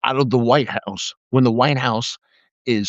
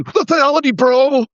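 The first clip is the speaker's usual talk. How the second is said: high, loud mock bro shout; imitating a fake alpha bro; 11 semitones higher, 5 dB louder than usual. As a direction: ham up a meathead yelling. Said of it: "idiots who scream".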